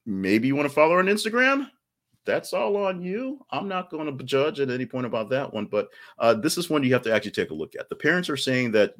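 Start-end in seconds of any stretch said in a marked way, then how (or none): 0.1-5.6 wink at the audience; teasing. Real, not acted. Teasing viewers about following her.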